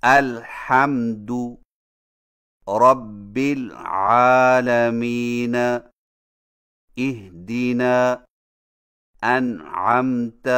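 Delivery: الحمد رب العالمین recited slowly, with firm, still stopped letters; slow, correct Quranic recitation of اہدنا and انعمت; correct sukoon. Shown as a demonstration. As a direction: hold the م in الحمد and the ع in انعمت steady, then move straight on. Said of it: "مثلاً".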